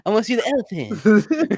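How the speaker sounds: high, loud goofy voice; silly mock voice. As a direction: play a dopey kid. Mocking a lisp joke.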